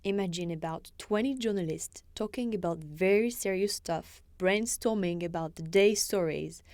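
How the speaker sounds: ordinary talk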